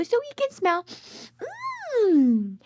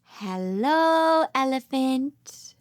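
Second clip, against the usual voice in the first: usual speaking voice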